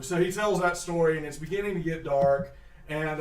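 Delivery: ordinary talk, nothing marked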